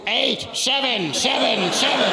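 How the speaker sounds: mock a stroke-slurred count, stuck on one number; Dick Clark after stroke. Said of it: "Dick Clark with the stroke".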